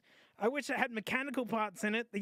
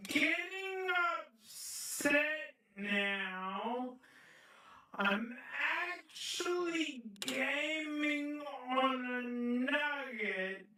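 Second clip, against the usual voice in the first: slowed-down replay; 6 semitones higher, much slower than usual. Shown as a demonstration. Slowed replay of own words.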